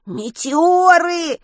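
panicked drawn-out shout; desperate cry. A narrator's reading. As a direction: scream the warning in terror. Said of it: "отчаянно прокричал Веня".

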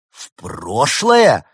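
loud, very drawn-out astonished question; collective gasp. A narrator's reading. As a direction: gasp it slowly in disbelief. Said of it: "ахнула аудитория".

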